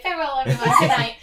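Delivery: loud, lightly voiced princess; a hint of a gracious princess calling out; princess farewell. Casilla backing out of the party, mild princess voice. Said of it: "and then the princess way".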